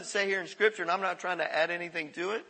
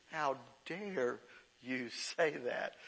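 lower, softer, mock indignation; offended retort; 6 semitones lower, 10 dB quieter, much slower than usual. Offended person to a critic, mock indignant. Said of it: "that's all we hone in on".

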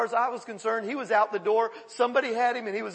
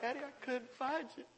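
soft, plaintive little voice; lost child; 3 semitones higher, 12 dB quieter than usual. Acted. Lost people calling to God as Daddy, soft child voice. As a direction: echo a small lost child, very softly.

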